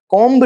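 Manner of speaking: "कॉम्ब" with a clear B after the M; the wrong "कॉम्ब", B audible; B wrongly sounded. Shown as a demonstration. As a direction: hit the final ब in "कॉम्ब". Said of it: "बी नहीं बोलेंगे".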